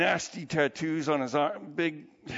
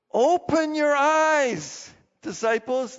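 slow, much higher and louder, proclaiming; Jesus calling out; 10 semitones higher, 8 dB louder, slower than usual. Acted. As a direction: boom it out like Jesus commanding his followers. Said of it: "He says".

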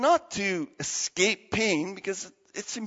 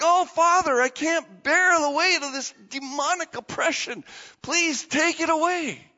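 pleading; 9 semitones higher than usual. Retold or imitated. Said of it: "he's like".

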